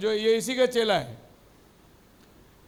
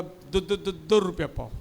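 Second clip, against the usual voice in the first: lower, soft stutter on दो; stammering seller; 5 semitones lower, 6 dB quieter than usual. Acted. The seller stammering his price back, lower and soft. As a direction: repeat दो haltingly. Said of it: "दोनों तोतला है".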